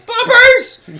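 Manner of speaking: shrieking high commentator yell; Jerry Lawler scream. Retold or imitated. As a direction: scream it like a manic ringside announcer. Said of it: "Jerry the King Lawler scream".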